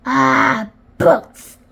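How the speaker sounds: loud, disgusted pirate growl; grumpy pirate captain's outburst. A narrator's reading. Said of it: "growled Captain Bones".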